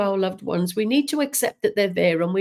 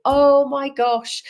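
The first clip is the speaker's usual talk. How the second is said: a touch of slow, higher astonished gasp of words; mild retold surprise; 5 semitones higher, 4 dB louder, slower than usual. Medium retelling the widower's amazed reaction, mildly surprised. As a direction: echo his stunned reply, slower and higher.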